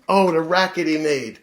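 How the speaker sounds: usual speaking voice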